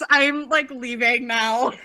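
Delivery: giggling mock exit; laughing. Real, not acted. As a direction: laugh while pretending to leave.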